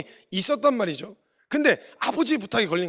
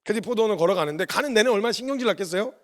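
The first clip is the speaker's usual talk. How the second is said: ordinary talk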